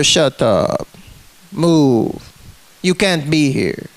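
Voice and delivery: low, curt mock cop orders; imitated cop. Retelling cop ordering him.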